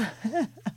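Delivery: soft laugh. Real, not acted. Laughs at Tilikum's remark.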